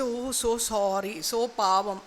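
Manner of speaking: put-on commiseration; mock sympathy. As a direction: coo fake pity, slowly and softly. Feigned sympathy for disliked person.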